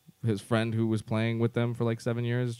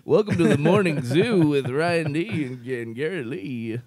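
radio DJ; 3 semitones higher, slower than usual. Pretend. Mock radio show intro.

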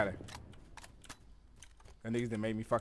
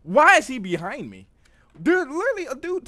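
loud outburst; 12 semitones higher, 11 dB louder than usual. Real, not acted. Gamer surprised by an enemy.

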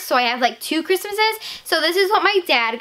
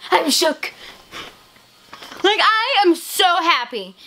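slightly higher breathless joy; giddy; 4 semitones higher, slower than usual. Real, not acted. Kid still reeling from a gift, giddy.

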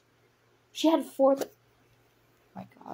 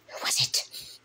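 whispered repeat; 3 dB quieter, slower than usual. Acted.

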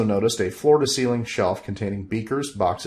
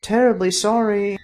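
high, loud little head voice; apologetic second head, pitched up; 12 semitones higher, 5 dB louder than usual. Acted. Head apologizing to Bob for the other, in a high voice.

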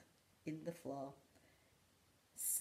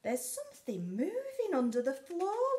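whispered Percy; 13 semitones higher, 9 dB louder than usual. Acted. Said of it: "whispered Percy".

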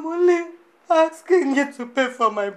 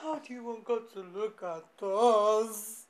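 ordinary talk, nothing marked

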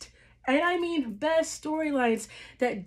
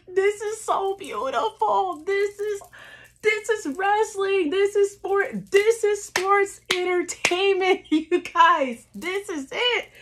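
ecstatic awe; 7 semitones higher than usual. Retold or imitated. Fan reliving the main event, ecstatic gushing. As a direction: gush like it's the best thing ever. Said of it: "But I'm like".

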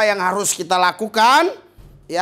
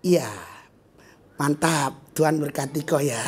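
soft, lower sweet reply; softly forgiving comeback; gentle blessing; 6 semitones lower, 4 dB quieter than usual. Retold or imitated. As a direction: answer the insult with quiet kindness. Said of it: "Kau balas".

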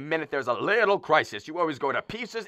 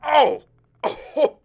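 high, loud yelp of pain; pained cry; 7 dB louder than usual. Acted. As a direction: cry out as it hurts. Man hurt, crying out.

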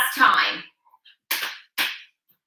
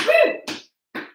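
whoop; 13 semitones higher than usual. Real, not acted. Instructor cheering the class.

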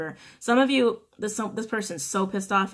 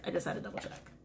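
breathy whisper to herself, much softer; whispered aside; 2 semitones lower, 12 dB quieter, faster than usual. Real, not acted. Reader muttering to herself after checking the card, whispered. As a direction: whisper it under your breath.

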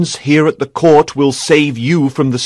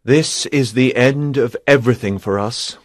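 low, subdued sorrow; sad; 5 semitones lower, 4 dB quieter than usual. A narrator's reading. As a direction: say it sadly, pitched down. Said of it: "he told her sadly".

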